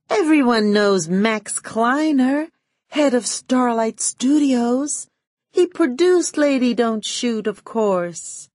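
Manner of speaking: high, slow starlet voice; lofty high starlet gushing; Giddy's voice. A narrator's reading. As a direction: gush in a high, drawn-out coo.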